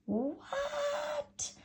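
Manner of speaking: very high, shrill disbelief; a squealed, stunned "What?"; shocked cry. Acted. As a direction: shriek in shock. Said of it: "my darlings cried".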